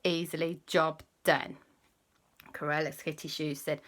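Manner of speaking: ordinary delivery, nothing marked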